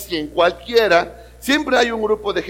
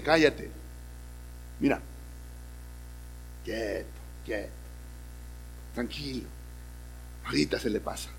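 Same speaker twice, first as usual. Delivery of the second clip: soothing calm; 6 semitones lower, 13 dB quieter than usual. Acted. Inner peace calms angry spouse.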